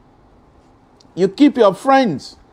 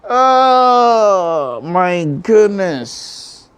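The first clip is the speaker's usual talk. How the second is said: real shock; much slower than usual. Real, not acted. Radio host learns of a death, stunned slow grief. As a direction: let the news hit you. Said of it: "May her soul rest in peace".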